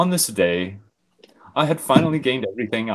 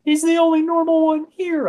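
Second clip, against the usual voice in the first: high, hammed-up whimpering plea; mock whimper; 6 dB louder than usual. Narrator whimpering to keep Richard. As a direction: whine it like a pouting child. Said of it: "I said, whimpering".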